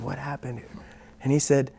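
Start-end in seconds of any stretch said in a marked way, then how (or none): 0.0-0.7 much softer, spent question; quietly asking, still worn out. Retold or imitated. Tired student asking teacher again, softly. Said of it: "I said".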